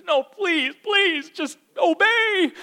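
high, loud, drawn-out begging; pleading parent. Acted. Parent begging child to obey, exaggerated pleading. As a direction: beg desperately, hammed-up. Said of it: "you don't wanna do it".

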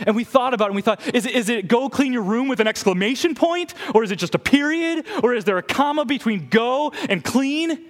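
put-on high, eager kid over-explaining; a kid breathlessly analysing dad's words; excited child. Child rambling about dad's command.

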